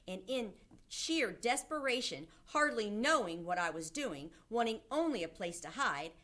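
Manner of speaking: ordinary delivery, nothing marked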